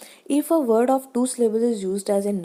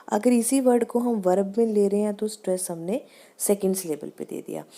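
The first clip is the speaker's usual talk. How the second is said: ordinary talk, nothing marked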